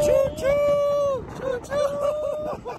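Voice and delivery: very high hooted choo-choo; train whistle imitation. Playing the locomotive on a train trip, high whistle hoot.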